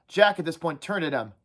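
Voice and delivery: ordinary delivery, nothing marked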